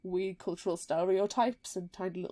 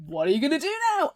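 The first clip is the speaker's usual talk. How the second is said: mock announcer hype; 6 semitones higher, 6 dB louder, much faster than usual. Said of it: "it's kind of like".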